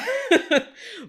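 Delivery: a sudden high, loud laugh. Real, not acted. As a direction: burst out laughing. Laughs at own aside.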